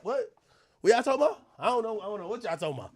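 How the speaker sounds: ordinary delivery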